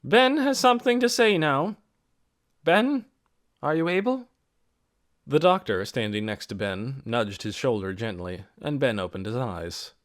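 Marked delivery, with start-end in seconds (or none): 0.0-4.3 formal speech. A narrator's reading. Mary addresses the meeting.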